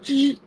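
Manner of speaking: short high-pitched "jeee" sound; imitated reaction noise. Co-host retelling how his face changed, imitated high noise. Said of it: "then his face went like".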